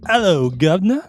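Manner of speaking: a bad Cockney greeting, done big; over-the-top British accent, loud and high; English accent. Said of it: "I thought I was talking to King Charles himself".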